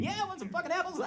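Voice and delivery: very high, fast, squeaky squeal; screaming baby. Retold or imitated. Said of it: "his face was just screaming".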